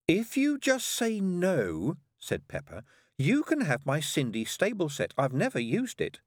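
a hint of a bossy girl; lightly voiced bargaining older sister. A narrator's reading. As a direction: bribe a little sister.

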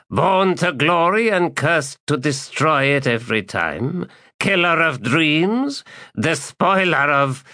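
high, slow, sneering litany; mocking ancient Jagut. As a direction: rattle off titles with scorn. Jagut mocking Calor's titles.

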